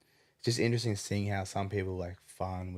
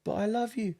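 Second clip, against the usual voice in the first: high, eager hawker calling out; mock the sellers' pushy welcome; hammed-up street vendor greeting; market seller voice. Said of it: "they'll be like".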